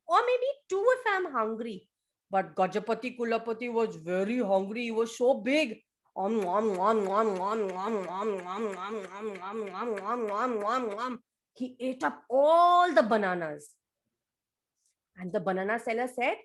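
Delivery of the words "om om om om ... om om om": low gobbling om om; munching sounds. Acted. Elephant gobbling bananas, low munching noises. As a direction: chomp through the bananas.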